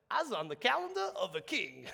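hammed-up name-dropping; mock boast. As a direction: brag grandly for a laugh. Mock boast about meeting the king.

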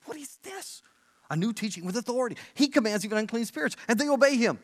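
high-pitched astonishment, hushed at first, then rising in volume; amazed. Acted. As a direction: play a stunned crowd arguing among themselves. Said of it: "Then they were amazed".

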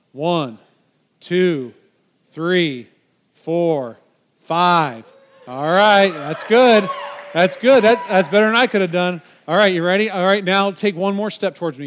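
rally the volunteer like a coach; loud cheering. Real, not acted. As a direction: count out loud and cheer him on. Cheering volunteer through push-ups.

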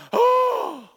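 mock crowd gasp; shocked gasp. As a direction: gasp like the crowd seeing the earth split. Crowd sees ground open.

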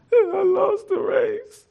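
put-on high, slow, wailing child's voice; mock crying child. Pastor voicing his crying nephew at the track, high slow wail. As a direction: whimper it like a small boy who lost.

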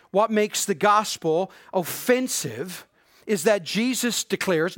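ordinary talk, nothing marked

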